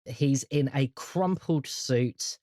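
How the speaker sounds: normal talking voice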